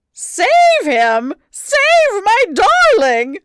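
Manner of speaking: very high, very loud, slow shriek. A narrator's reading. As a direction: scream for her pet's life. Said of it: "shrieked the dowager".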